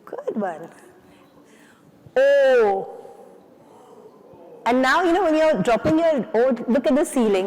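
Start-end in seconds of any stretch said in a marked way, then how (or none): 2.2-2.9 long loud oh. Shown as a demonstration. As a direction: drop the oh hard. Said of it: "Imagine you're gonna hit the floor".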